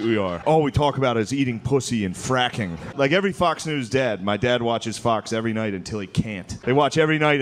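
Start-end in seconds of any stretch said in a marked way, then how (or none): none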